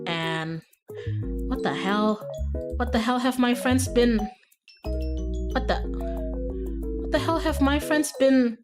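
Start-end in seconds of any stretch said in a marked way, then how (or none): none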